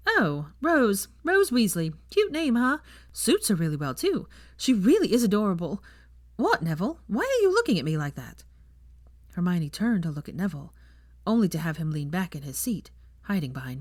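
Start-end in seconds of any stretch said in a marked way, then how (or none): 0.1-8.4 lightly voiced bubbly teacher, higher; proud, breezy professor; chatty young witch. Teacher brags about her lessons to guests, breezily.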